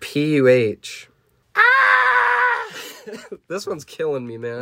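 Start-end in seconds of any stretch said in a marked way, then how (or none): 1.5-2.7 very high held screech; piercing cartoon scream; acted scream. A narrator's reading. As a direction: screech as the monsters being hit. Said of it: "They screamed".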